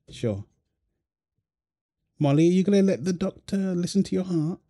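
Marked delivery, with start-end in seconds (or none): 2.2-4.6 higher, gentle coaxing voice. Real, not acted. To little Molly at the doctor, coaxing baby talk.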